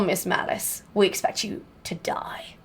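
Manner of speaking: breathy villain whisper; mock villain menace, whispered; whispered Bond villain line. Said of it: "Bad accent?".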